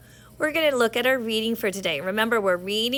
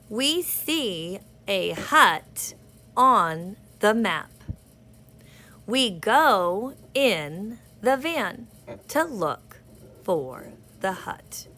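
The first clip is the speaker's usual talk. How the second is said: very slow, word by word; slow read-along; much slower than usual. Shown as a demonstration. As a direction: point and read each word. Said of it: "put your finger on the dots as we go".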